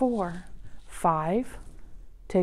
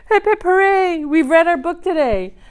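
cheerful; 6 semitones higher, 5 dB louder than usual. Real, not acted. Cheering end of book.

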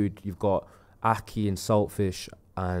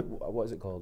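usual speaking voice

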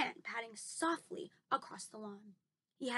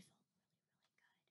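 whispering; 47 dB quieter, faster than usual. Real, not acted. Whispers how good waking early felt.

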